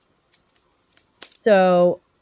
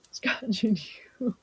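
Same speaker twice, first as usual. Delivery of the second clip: scotch and yoo-hoo breathed through a giggle; laughing whisper; 2 semitones higher, 8 dB quieter than usual. Real, not acted. To chat, repeating the drink idea in a giggly whisper. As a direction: whisper it, barely holding back laughter. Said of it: "repeats whispering, laughing".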